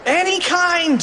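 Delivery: high-pitched frantic yell; shrieked cartoon reply; exasperated mock scream. A narrator's reading. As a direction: scream it high at a slow friend. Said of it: "screamed Fluffy".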